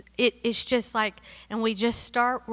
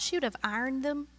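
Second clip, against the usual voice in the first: ordinary talk, nothing marked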